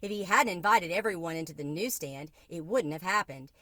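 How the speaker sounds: usual speaking voice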